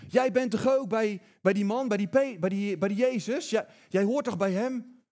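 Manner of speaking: high, loud, pointed accusation; a servant girl accusing Peter loudly in the courtyard. Retold or imitated. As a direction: call him out like a nosy servant girl. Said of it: "die zegt".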